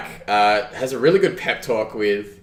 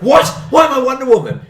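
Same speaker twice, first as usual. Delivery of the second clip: very high, loud mock outrage; imitated indignant shriek; outraged disbelief; 8 dB louder, faster than usual. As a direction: squawk it in hammed-up disbelief. Acting Davari's outraged reply in story.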